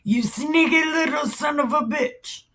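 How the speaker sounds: high-pitched comic scolding; hammed-up insult to a little brother; mock angry. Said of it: "he was mad as fuck".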